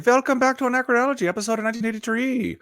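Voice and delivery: fast, high host intro; announcer voice. Acted. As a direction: pitch it like a show host. Podcast intro announcer read.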